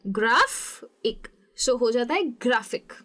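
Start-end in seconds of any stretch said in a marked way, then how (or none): 0.0-1.3 "graphic" split, strong GRAF, lighter IK; syllable demo of "graphic", GRAF stressed; stress on first syllable. Shown as a demonstration. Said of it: "पहले वाले पे किया है". 2.4-2.9 "graphic" with strong GRAF, IK fading out; front-stressed "graphic"; stress on first syllable. Shown as a demonstration. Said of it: "थोड़ा fade out हो रहा है".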